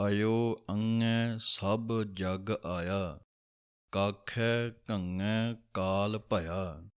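slow, careful Gurbani line reading; slow recitation. Shown as a demonstration. Teacher reciting a scripture line slowly. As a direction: recite each word slowly and clearly. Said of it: "ਇਸ ਤਰ੍ਹਾਂ ਇਹਨੂੰ ਅਯੋ ਬੋਲਣਾ ਹੈ".

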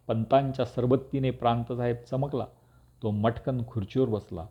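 usual speaking voice

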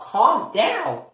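normal talking voice

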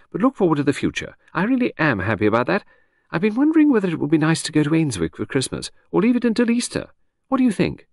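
breezy chatter that floats from topic to topic; Lucy, lady of the house. Acted. Chatting to her husband in the garden, airy high lady.

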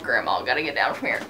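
mock grandma croak; old grandma voice. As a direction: play a little old grandma climbing down. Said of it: "Oh, I'm like".

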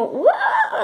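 very high squealing uah like a ghast; mock a ghast's shriek, way up high; playful ghast screech impression; imitated ghast scream. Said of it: "Gritan como".

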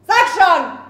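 shouted demand; furious demand. Acted. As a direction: bark it at him. Demanding an answer at gunpoint.

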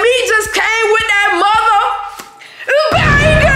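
long, high drawn-out holler; real hype-man cry, held long; hyped shout. Real, not acted. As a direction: stretch the word out in a high yell. Hyped outro shout about the prank.

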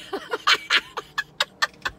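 very high rising laugh; squealing laugh. Real, not acted. Overexcited about the cookie, high squealing laugh.

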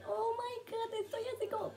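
very high, breathy giggling gasp; overwhelmed squeal. Real, not acted. Fan overwhelmed by Harry's video, high giggling squeal. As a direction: melt into helpless laughter.

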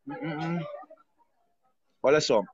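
ordinary talk

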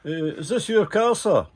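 hammed-up English cop asking about the car; mock copper voice. Cop voiced in retold story.

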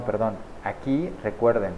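usual speaking voice

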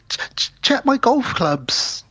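slow, whispered stutter; hammed-up guilty stammer, whispered. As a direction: falter out the excuse. Caught, stammering.

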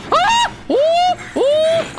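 very high, loud panicked shrieks; real frightened yelps; terrified shriek. Real, not acted. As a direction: let out real startled screams. Chased in horror game.

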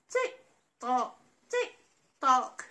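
crisp clock ticks; ticking clock. Acted. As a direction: tick like a clock. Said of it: "went the clock".

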